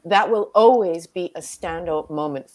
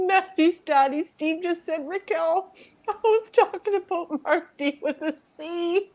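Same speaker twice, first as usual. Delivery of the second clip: laughing; 9 semitones higher than usual. Real, not acted. Reading a funny comment aloud.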